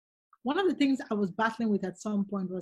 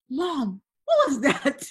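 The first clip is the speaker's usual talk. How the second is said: louder, higher startled question; shocked kids; 4 semitones higher, 6 dB louder, much slower than usual. Retold or imitated. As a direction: voice the kids' shock at mom. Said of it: "They were a bit shocked".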